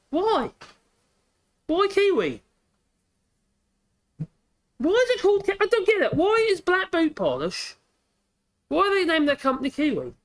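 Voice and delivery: high, incredulous why questions; genuinely baffled, pitch jumping up; baffled disbelief. Real, not acted. Vlogger baffled by the shoe polish name, high incredulous questions. Puzzling over brand name Kiwi.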